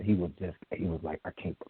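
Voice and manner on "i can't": low, muttered mock fury; quietly mad, trailing down under the breath; retold seething anger. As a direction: imitate someone fuming to himself, sinking very quiet. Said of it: "you could just see he was mad".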